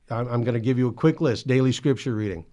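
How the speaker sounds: usual speaking voice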